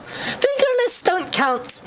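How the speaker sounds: high, slow sing-song correction; sweetly overenthusiastic; chirpy girl voice. Acted. Rosa answering Fane.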